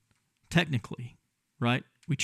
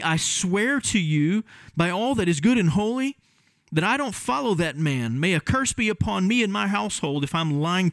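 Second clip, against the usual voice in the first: higher, heated oath-swearing Peter; cursing denial; 7 semitones higher than usual. Acted. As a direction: swear and curse to shake them off. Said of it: "Maybe Peter would have sounded something like this".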